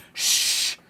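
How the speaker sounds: unvoiced librarian shush. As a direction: hush them like a librarian. Librarian shushing in imagined cutaway.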